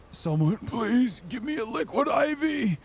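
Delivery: pained plea. Retold or imitated. Begging for fluids while cramping.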